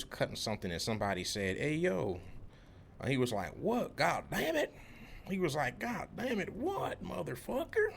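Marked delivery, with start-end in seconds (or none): 1.6-2.2 high-pitched mock call for attention. Retold coworker calling someone's name. 3.6-4.7 high-pitched mock angry cussing; mad country coworker. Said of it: "he'll get mad and start cussing". 5.8-7.9 very high mock-angry cussing, drawn out; hammed-up exasperation at being interrupted; mad country coworker. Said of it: "he'll get mad and start cussing".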